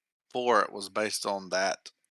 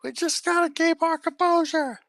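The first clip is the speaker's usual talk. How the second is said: climbing ever higher, barely holding it together; squeaky forced calm; mock panicked crewmate; 14 semitones higher than usual. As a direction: crew fighting panic at Spock's change, voice climbing high. Crew trying not to panic.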